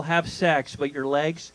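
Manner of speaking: ordinary talk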